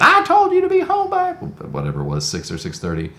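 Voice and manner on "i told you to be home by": high, loud angry yell; shrill it like a mother who's had enough; mother flipping out. Retold or imitated. Mother yelling at her late teenage son, angry yell. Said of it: "And she flipped out".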